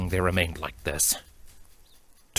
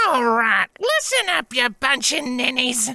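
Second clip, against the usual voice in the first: put-on old mayor barking for attention; elderly mayor shouting; 14 semitones higher than usual. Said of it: "an elderly-sounding mayor shouted".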